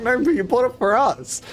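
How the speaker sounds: high-pitched mock protest; protesting roommates. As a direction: imitate an aggrieved housemate objecting. Roommates protest in retold argument.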